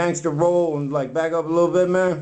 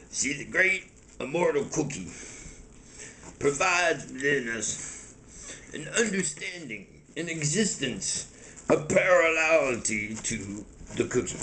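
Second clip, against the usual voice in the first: solemn cookie mystic; 6 dB quieter than usual. Acted. Cookie Monster expounding on the great immortal cookie, solemn mystic.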